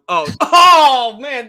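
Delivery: oh man laughed out loud and high; laughing. Real, not acted. Co-host laughing at his buddy's jab, loud high laughter. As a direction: crack up at the bad news.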